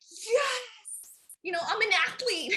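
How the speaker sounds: high, playful boast; mock triumph. Runner recalling her first full mile, triumphant. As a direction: celebrate like a newly crowned champion.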